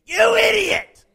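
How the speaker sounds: furious high shout, drawn out; a scolding roar of insult; angry yell. Retold or imitated. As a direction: bellow it right in his face. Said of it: "he don't get in my face and go".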